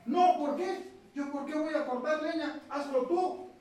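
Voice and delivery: retold shouted refusal; yelling back. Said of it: "el joven empezó a gritarle también".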